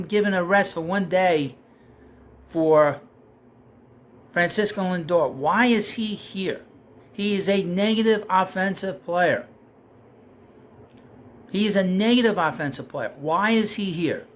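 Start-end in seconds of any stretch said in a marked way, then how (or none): none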